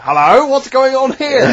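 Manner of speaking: high, slow nosy busybody; nosy neighbour voice. Acted. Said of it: "What's Mister Nosy Neighbour interested in?".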